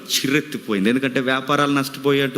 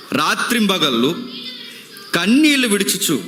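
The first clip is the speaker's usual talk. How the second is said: dramatic scripture reading; 6 semitones higher than usual. Acted. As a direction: declaim the warning like a prophet. Reads scripture over music.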